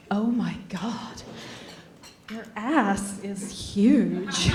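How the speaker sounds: slow, hushed horrified whisper; mock appalled whisper; whispered dismay. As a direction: murmur it like a shocked secret. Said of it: "Guys would be like".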